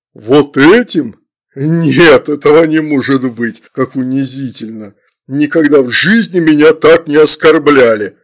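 loud, offended disbelief; indignant demon. A narrator's reading. Demon humiliated by child summoners, indignant. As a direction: protest in wounded, booming outrage.

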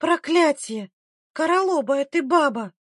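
high, exasperated curse; angry cry. A narrator's reading. Midwife thrown from the doorstep, angry cry. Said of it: "закричала от злости".

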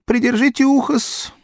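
high, fawning barber; obsequious barber. Acted. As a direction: ask like a servile barber. Barber asks politely.

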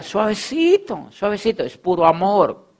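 suavecito, said soft and unhurried; gentle. Retold or imitated. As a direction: coax it gently, like calming someone. Quotes a soft audio message.

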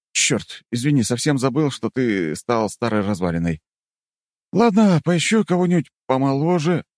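high mock apology; teasing. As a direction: tease the old man. Hero teasing.

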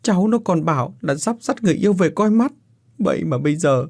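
high, choked mother breaking off mid-sentence; grieving mother cries her words out high; sobbing, broken speech. Acted. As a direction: sob the words out in broken pieces. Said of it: "nức nở nói đứt quãng".

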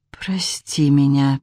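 slow, whispered, rasping; guilty girl rasping to her father; hoarse whisper. A narrator's reading. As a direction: barely get the words out. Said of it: "прохрипела она".

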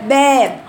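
«باب» بفك نازل وصوت أعلى; أنزل الفك في «باب»; با خاطئة. Shown as a demonstration. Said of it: "لأن نزلت با". («باب» بصوت هابط للفك)

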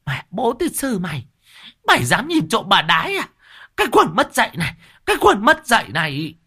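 hammed-up screeching abuse; swinging a shovel, shrieking curses; furious cursing woman. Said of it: "khua vù vù".

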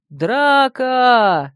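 loud shouted alarm. A narrator's reading. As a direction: call out Драка! loudly. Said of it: "закричал кто-то".

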